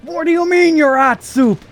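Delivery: hammed-up frantic phone argument; frantic mock shout. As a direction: shriek at the absurd friend. Phone skit escalates.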